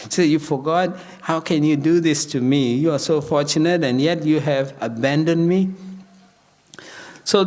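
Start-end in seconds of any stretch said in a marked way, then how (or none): none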